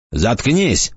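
plain reading voice

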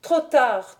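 mounting anger, first cry; growing fury. A narrator's reading. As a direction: start the rising rage. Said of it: "avec une fureur croissante".

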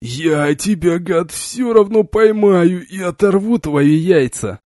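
hoarse croak through a mouth of blood; slow, wheezing threat through pain; bloody mouth. A narrator's reading. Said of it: "Сквозь боль и слёзы прохрипел".

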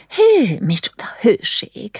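breathless, whispered gasp; panting whisper. Acted. Mother at the hilltop, breathless from the climb. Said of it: "zihálta".

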